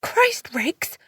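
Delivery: sudden high yelp of fright; startled heroine crying out his name. A narrator's reading. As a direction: jump at being grabbed.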